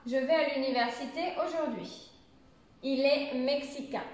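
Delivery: falling end on AUJOURD'HUI and MEXICAIN; demonstrated statement tune, "aujourd'hui" and "mexicain" going down; affirmative intonation. Shown as a demonstration. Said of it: "Je le redis une autre fois".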